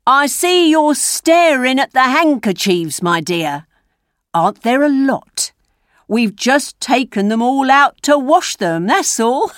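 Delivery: put-on high-pitched old schemer; old rogue. A narrator's reading. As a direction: explain it lightly, as a sly joke.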